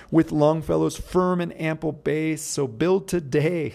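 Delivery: poem recital. Shown as a demonstration. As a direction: declaim the lines like a recital. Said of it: "sir, I'll go back".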